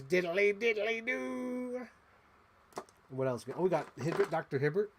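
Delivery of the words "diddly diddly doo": much higher, slow sing-song cartoon neighbour; Flanders impression. Fan voicing the Flanders toy, sing-song and high. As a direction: chirp it like a chipper cartoon neighbour. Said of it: "Wolfman Flanders".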